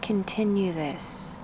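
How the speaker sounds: unhurried meditation guide, with long pauses between phrases; guided meditation. Acted. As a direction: ease the listener into stillness, spacing out each instruction.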